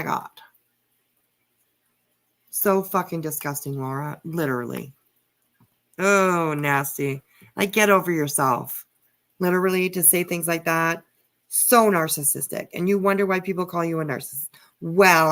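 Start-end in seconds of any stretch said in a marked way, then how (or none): none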